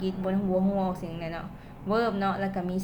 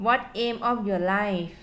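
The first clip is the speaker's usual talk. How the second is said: slow model sentence; much slower than usual. Shown as a demonstration. Teacher to students giving an example, very slow. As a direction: read it out slowly for learners to copy. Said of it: "ตัวอย่าง".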